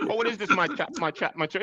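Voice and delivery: high, laughing mock quote; Godfrey. Fan acts out a comedian's bit for amused friends, high comic imitation. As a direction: put on a comedian's high-pitched puzzled routine. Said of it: "That's what he, he said".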